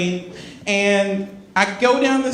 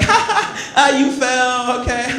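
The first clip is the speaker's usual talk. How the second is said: mocking laugh; 6 semitones higher, 3 dB louder than usual. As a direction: laugh at him like it's still funny. Mocking friend who stumbled.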